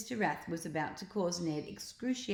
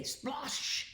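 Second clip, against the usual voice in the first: splash noise; 8 semitones higher, 4 dB quieter than usual. A narrator's reading. Splash sound effect.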